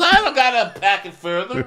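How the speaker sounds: high, loud, fast bratty retort; mock defiant guest. Guest snapping at the host in a high bratty voice. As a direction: toss it back in a high snotty voice.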